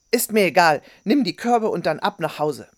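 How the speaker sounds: loud, impatient order; curt interruption. A narrator's reading. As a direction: cut him off sharply and loudly. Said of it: "fiel ihm ins Wort". (barsch ins Wort fallend)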